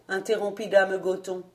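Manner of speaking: usual speaking voice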